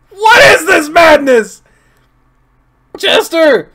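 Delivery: high loud outcry; shocked shout. Real, not acted. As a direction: yell it in disbelief. Shocked by scene.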